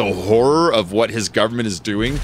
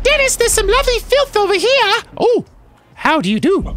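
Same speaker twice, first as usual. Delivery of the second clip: Monty Python lady. Acted. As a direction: screech like a Python pepperpot. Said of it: "Monty Python lady".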